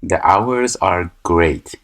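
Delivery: very slow and drawn out; slow teacher reading the line; slow read-aloud. Shown as a demonstration. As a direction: read each word out slowly for the class. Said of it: "reading the exercise aloud".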